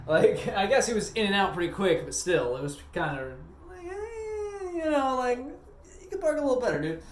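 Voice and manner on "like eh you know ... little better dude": much higher, put-on voice, teasing a bad parker; sing-song high jab at a sloppy parker; mock high scolding. Gas station clerk silently mocking a customer's bad parking, teasing high put-on voice. Voicing his thought to the parker.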